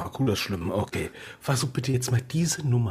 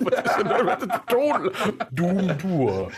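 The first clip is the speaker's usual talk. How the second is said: higher, laughing through the words; 7 semitones higher, much slower than usual. Real, not acted. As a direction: giggle in disbelief. Laughs at Doom Dur.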